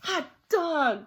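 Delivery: high, loud, stretched cheer; gleeful storybook shout; excited cry. A narrator's reading. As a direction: cheer like kids let loose. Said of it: "cried the kids".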